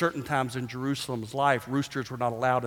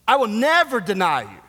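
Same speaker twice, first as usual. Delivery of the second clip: loud vow; 7 semitones higher, 8 dB louder than usual. Acted. Preacher voicing boastful Peter.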